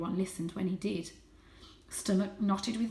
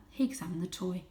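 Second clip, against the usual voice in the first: plain reading voice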